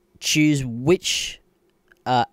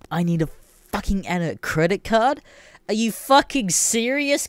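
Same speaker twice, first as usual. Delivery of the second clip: high and louder, building indignation; heated rant; 7 semitones higher, 4 dB louder than usual. Real, not acted. Ranting at Apple over the card, heated and high. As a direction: spit out every grievance.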